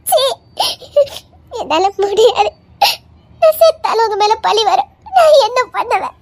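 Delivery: weeping through every word, voice catching; crying despairing wife; sobbing. Acted. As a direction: plead through tears as the world falls apart. Wife told to leave, sobbing.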